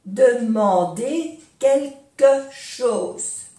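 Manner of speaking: "Demander quelque chose" slowly, each syllable apart. Shown as a demonstration. Teacher modelling for learners, "Demander quelque chose" slowly syllable by syllable.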